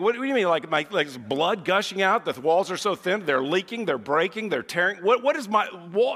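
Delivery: high, loud rush of alarm; hammed-up heart-attack panic. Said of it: "I'm instantly in a panic".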